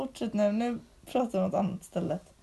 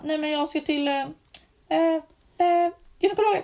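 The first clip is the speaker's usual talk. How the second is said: mock embarrassed hesitation; 5 semitones higher, 4 dB louder than usual. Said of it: "Inte så att man kan bara".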